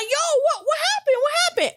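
high, puzzled yo what happened; confused Mark. Retold or imitated. Mark asks why the crowd is yelling, high and puzzled. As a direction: sound lost while everyone yells at you.

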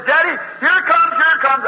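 put-on high, urgent child voice; little boy alarmed as death approaches; frightened child. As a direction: cry out like a scared little boy.

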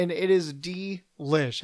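慢慢品味地说; savoring slowly. Acted. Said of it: "milking it along".

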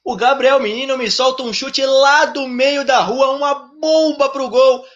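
high, thrilled goal narration, slowing at peaks; explosive goal commentary. Real, not acted. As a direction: stretch the big moments with delight. (narração empolgada de gol)

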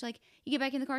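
ordinary talk

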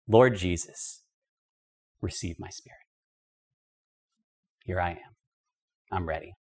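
soft dying prayer. Acted.

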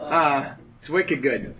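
slow, hammed-up New England accent. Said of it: "in the New England accent".

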